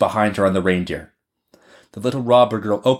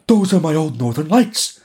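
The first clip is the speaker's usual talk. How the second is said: higher, wondering animal voice; reindeer exclaiming; 6 semitones higher than usual. A narrator's reading. Reindeer spots its northern lights, exclaiming. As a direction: marvel as the reindeer, pitched up. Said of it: "the reindeer said".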